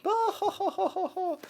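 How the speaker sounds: very high imitated belly laughs; mock crowd laughter. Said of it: "everyone's like".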